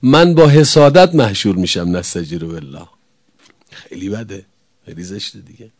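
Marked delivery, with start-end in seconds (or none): 2.0-5.7 hushed aside. Real, not acted. Preacher's dismayed aside.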